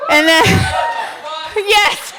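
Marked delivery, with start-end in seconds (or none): 0.5-1.5 delighted shriek laugh; high shrieking laugh. Real, not acted. Laughs at the rhyme punchline.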